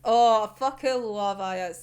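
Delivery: usual speaking voice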